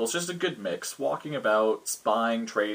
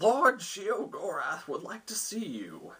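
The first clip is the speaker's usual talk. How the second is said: high, slow, swooping guard voice; town guard; 8 semitones higher, slower than usual. Acted. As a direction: announce a lord's summons with drawn-out pomp. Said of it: "one of the guards".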